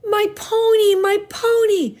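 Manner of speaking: put-on very high, childlike squeal; excited cry. A narrator's reading. Laura sprinting out the door to the trailer, excited squeal. Laura dashes out to her pony.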